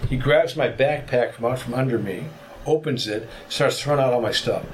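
normal talking voice

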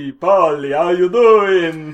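greaser voice. Pretend. Greaser flirting with a friend.

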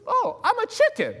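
very high, silly chicken-man voice; chicken character. Acted. Pastor acting the chicken in the mission skit, high and silly. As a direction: squeak it out like a goofy chicken. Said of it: "He says".

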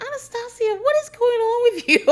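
high, laughing what-is-going-on; laughing disbelief. Real, not acted. Reacting to Anastasia's clip, laughing in disbelief. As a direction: ask it while cracking up. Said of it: "laughing at Anastasia's clip".

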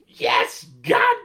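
high, loud, stretched crying shout; screamed victory. Retold or imitated. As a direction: scream it with every last bit of strength. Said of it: "he put such a long amount of time".

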